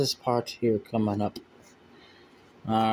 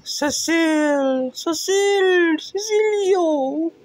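high shrill calling out; slower than usual. Acted. Calling out to Cecil.

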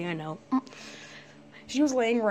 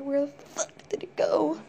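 tense cursing whisper; 5 semitones higher than usual. Real, not acted. Girl losing track of the spider, whispering.

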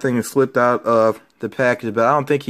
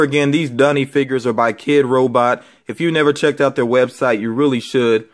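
normal talking voice

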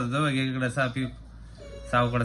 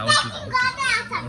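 very high, slow excited squeal; drawn-out squeal; much slower than usual. Real, not acted. Parent cheering baby getting up, high slow squeal. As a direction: stretch it out in delight.

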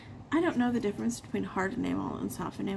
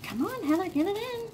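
frustrated pep talk; 11 semitones higher than usual. Real, not acted. Fumbling with baggy.

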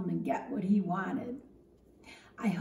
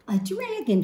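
surprised; 8 semitones higher, 4 dB louder than usual. Acted. Mother surprised by dragon request.

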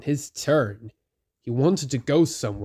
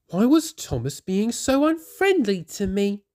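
sad Rosie; 7 semitones higher than usual. A narrator's reading. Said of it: "she said".

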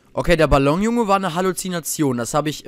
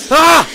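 sudden very high loud scream; a real terrified yell; jumpscare scream; 12 dB louder than usual. Real, not acted. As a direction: shriek in real fright.

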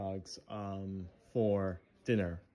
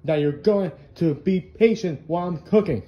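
angry yell; 3 semitones higher, 12 dB louder than usual. Acted. Cook yells at friend.

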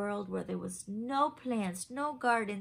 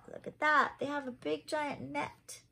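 usual speaking voice

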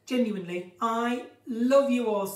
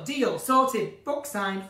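usual speaking voice

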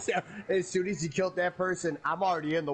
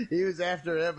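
ordinary talk

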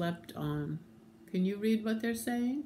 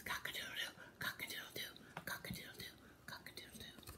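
repeated crow, fading to barely audible; whispered rooster crow; 16 dB quieter, much faster than usual. Acted. Voiceless rooster says it four times, in a fading whisper. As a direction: whisper each crow smaller and smaller. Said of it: "he's still whispering".

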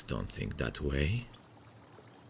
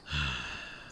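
heavy sigh; 5 dB quieter than usual. Real, not acted. Sad sigh mid-confession.

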